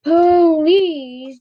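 loud, long drawn-out please; groaning. A narrator's reading. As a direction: groan it in exasperation. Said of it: "I groaned".

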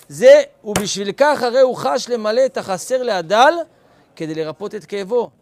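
loud, deliberate reading of the source; emphatic reading. Acted. Reading the text aloud.

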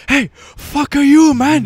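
put-on high, loud, slow accented yell; angry shop worker. Worker turns on the homeless guy, high mock-angry shout. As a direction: imitate a furious little clerk blowing up. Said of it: "the guy working turned, he's like".

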